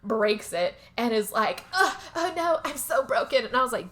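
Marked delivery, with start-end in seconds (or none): 1.7-3.4 mock groan. Mary groaning after fall.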